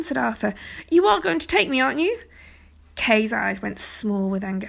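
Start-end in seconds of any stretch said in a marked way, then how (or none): 0.9-2.2 put-on high, urgent boyish pleading; anxious plea. A narrator's reading. Arthur begging to go to the tournament, anxious plea. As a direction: beg hopefully, voice pitched up.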